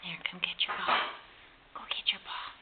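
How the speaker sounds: whispering. Real, not acted. Whispering to baby.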